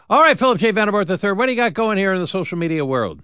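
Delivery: big, loud, fast handoff; loud radio handoff. Real, not acted. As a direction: boom the handoff to the co-host.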